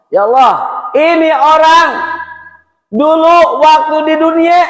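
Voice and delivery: high, loud complaint to God; hammed-up aggrieved outcry; acted accusation. Neighbour accusing the man before God.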